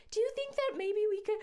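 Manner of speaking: very high, sugary friend voice; imitated sweet, tentative plea. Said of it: "literally couldn't be sweeter".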